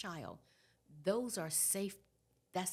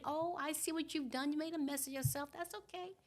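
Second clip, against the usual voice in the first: cooing mother; 7 semitones higher, faster than usual. Acted. Mama to her crying baby who made a mess, cooing. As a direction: coo indulgently to a baby, pitch lifted.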